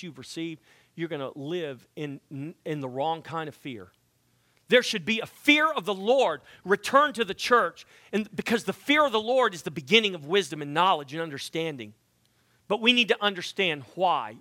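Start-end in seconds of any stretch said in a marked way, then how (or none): 4.7-14.4 louder, higher, driving the point home; fervent preaching. Acted. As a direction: preach it out with rising fire. Preaching at full force.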